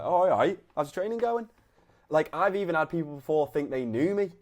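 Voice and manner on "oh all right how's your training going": breezy, friendly, much higher greeting to a mate; casual greeting. Shown as a demonstration. Coach demos greeting a gym stranger, casual and breezy. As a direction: greet them like a mate bumped into at the pub. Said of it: "That's what you need to be thinking".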